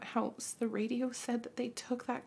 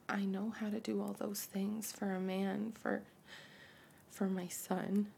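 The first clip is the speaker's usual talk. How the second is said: soft hushing, softer than speech; hushed whisper; 2 semitones lower, 7 dB quieter than usual. Acted. Mother whispering and shushing her son. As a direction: shh him quietly.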